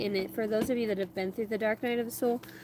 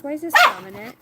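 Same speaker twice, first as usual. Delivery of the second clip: surprised; 9 semitones higher, 13 dB louder than usual. Real, not acted. Surprised at the card.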